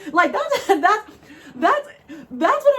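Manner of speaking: high, breaking into laughter; can't get the words out for laughing; real giggling fit. Real, not acted. Cracking up at the video.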